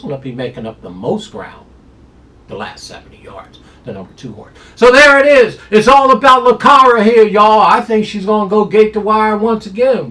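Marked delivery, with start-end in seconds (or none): 4.7-10.1 high, loud hyped-up call; excited hype. Real, not acted. Handicapper pumping up his top pick, loud and high. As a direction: shout out the top pick like a showman.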